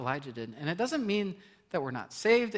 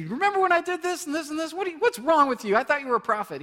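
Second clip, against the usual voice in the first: high, loud mock scolding; a higher, louder imitation of God scolding the prophet; scolding rebuke; 11 semitones higher, 7 dB louder than usual. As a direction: berate him like a disappointed boss. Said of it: "He didn't say".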